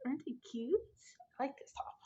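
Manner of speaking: breathy whispered aside. Real, not acted. Whispering to viewers about the top her mom bought. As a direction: whisper it like a little secret.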